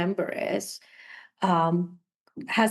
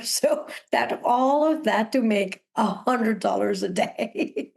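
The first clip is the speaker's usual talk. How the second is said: laughing through the line, a bit slow; 2 semitones higher, slower than usual. Real, not acted. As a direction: chuckle at your own hustle as you say it. Laughing while recalling many jobs.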